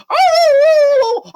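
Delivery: loud, very high howl; excited dog howl. Acted. Dog delighted to see Pippa, loud howl. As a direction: howl loudly like an overjoyed dog. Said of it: "drooling out of its mouth going".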